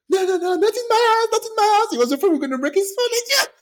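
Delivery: high, loud mock panic; frantic retold protest. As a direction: plead frantically, playing up the cousin's panic. Cousin protesting the fight, retold.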